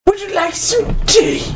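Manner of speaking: high, calling lady's voice; breathy vintage purr. Acted. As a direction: offer tea like a grand dame. GM voices Lady Jezebel offering tea.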